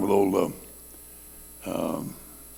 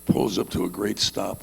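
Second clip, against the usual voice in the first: usual speaking voice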